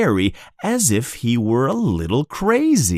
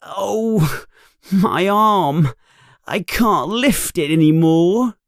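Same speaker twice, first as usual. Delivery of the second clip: high, strained groaning complaint; 9 semitones higher than usual. Acted. Ron groaning to Harry about his tired arm. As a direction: moan about an aching arm. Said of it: "He is groaning".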